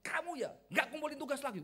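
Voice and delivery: high-pitched outburst at a student; re-enacted teacher's telling-off; retold scolding. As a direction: lay into the student, pitched well up. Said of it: "Saya langsung semprot".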